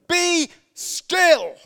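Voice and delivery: Jesus shouting "Be still" at the wind and waves; commanding shout. Acted. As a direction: bellow "Be still" at the storm.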